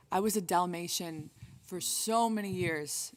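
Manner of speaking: usual speaking voice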